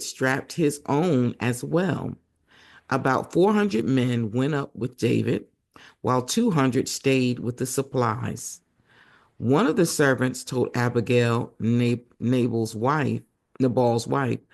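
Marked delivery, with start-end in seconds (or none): none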